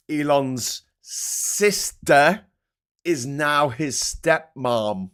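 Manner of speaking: slow, deliberate reveal; slow deadpan. Real, not acted. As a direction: drag it out for effect.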